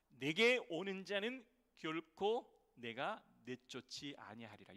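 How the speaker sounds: 결코 leaned on, slow and loud; stress on 결코. Shown as a demonstration. Pastor rereading the verse to stress 결코, slow and loud. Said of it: "여기에 결코 부정문이 두 번이나".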